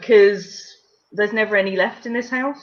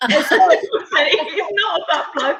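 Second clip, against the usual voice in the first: slow, higher, laughing through the words; laughing denial; 5 semitones higher, much slower than usual. Real, not acted. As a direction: protest while cracking up. Laughing over a rude guess.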